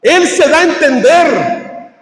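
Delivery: high, slow, drawn-out preacher's cry; full-force preaching. Real, not acted. Preacher confronting those who understand but won't accept, forceful preaching. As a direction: ring out each phrase high, stretching the key word.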